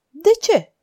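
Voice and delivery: high-pitched surprised question; startled "why?"; astonished. A narrator's reading. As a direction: ask it taken aback, pitch jumping up. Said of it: "făcu Montgomery uimit".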